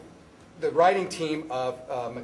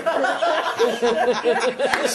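loud real laughter; 9 semitones higher, 6 dB louder than usual. Real, not acted.

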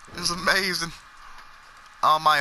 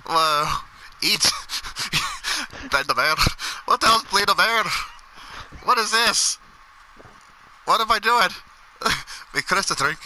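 ordinary talk, nothing marked

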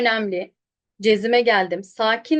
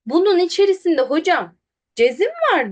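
student's protest; 6 semitones higher, 4 dB louder than usual. Retold or imitated. Acts out an arguing student.

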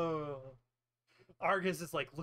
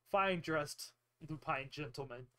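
ordinary talk

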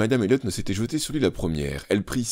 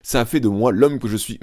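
roaring father; 5 semitones higher, 6 dB louder than usual. A narrator's reading. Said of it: "rugit monsieur Elliot".

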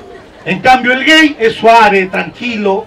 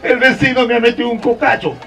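mock crying to dad; tearful child complaint; 5 dB quieter than usual. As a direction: whimper it like a kid telling on someone. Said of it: "te ibas donde tu papá llorando". (llanto fingido de niño quejándose)

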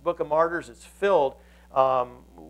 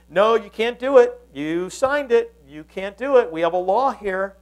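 raised, louder insistent protest; acted officials laying down the law; insistent objection; 4 semitones higher, 5 dB louder than usual. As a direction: press the king hard, stretching the key words. Officials press the reluctant king.

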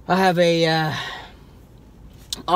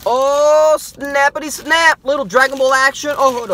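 loud, higher thrilled exclamations; excited exclaiming; 10 semitones higher, 7 dB louder than usual. Real, not acted. Finding a Dragon Ball figure in the box, loud and thrilled. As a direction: call it out like a prize find.

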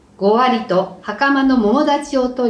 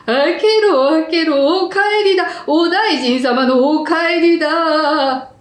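odd shouting voice; 5 semitones higher than usual. Acted. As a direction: bellow it out in a strange voice. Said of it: "おかしな声で外から怒鳴りました".

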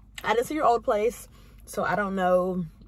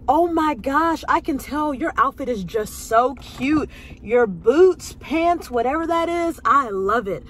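louder, higher gushing; gushing compliment; 3 semitones higher, 5 dB louder, slower than usual. Retold or imitated. A stranger in the nail shop bathroom, gushing louder. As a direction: gush like an excited stranger. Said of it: "this lady is like".

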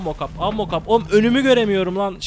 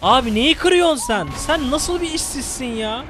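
incredulous scolding; 8 semitones higher than usual. Real, not acted. Scolding clumsy teammate. (takım arkadaşını azarlayan, inanamayan tiz ses)

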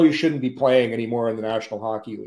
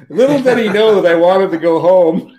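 ordinary delivery

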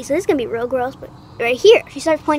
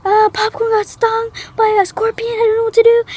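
high-pitched mock distress; retold panic; 8 semitones higher than usual. Child telling the teacher about the sting, acted panic.

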